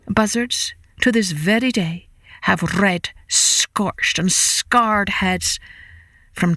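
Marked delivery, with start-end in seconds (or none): none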